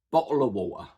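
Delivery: BOTTLE OF WATER with glottal stops for the T's. Shown as a demonstration. Teacher demonstrating Cockney's glottal stop, "bottle of water" without T's. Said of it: "most obviously heard in the phrase".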